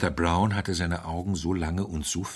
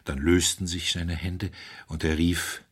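ordinary delivery, nothing marked

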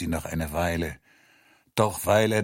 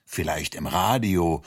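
plain reading voice

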